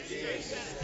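ordinary talk, nothing marked

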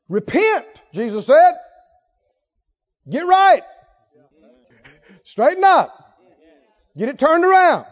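loud, high preacher's call; preaching at full force. Acted. Preacher urging the church, loud and high. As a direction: cry it out to the church.

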